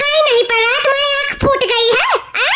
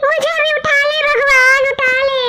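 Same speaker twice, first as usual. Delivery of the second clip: tearful prayer; 3 semitones higher than usual. Acted. Orphaned girl begging God to take her too, crying. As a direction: beg heaven through tears.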